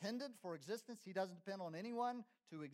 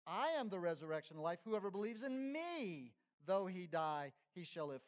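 preaching quote; 5 dB louder than usual. Acted. Preacher quoting Jesus emphatically.

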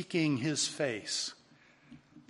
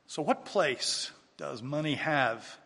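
ordinary talk

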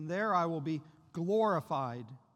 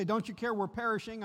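pleading cry; 5 semitones higher, 5 dB louder than usual. Acted. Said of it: "and they say".

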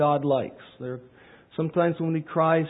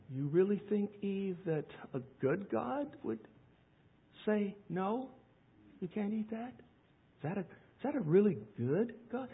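soft, sly serpent voice, much quieter; devil tempting Eve; 10 dB quieter than usual. Acted. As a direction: hush it like a tempter whispering to Eve. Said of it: "the old lie of the devil in the garden".

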